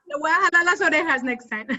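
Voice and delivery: quick playful threat through a laugh; joking scold, laughing fast; mock threat laughing. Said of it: "tell him".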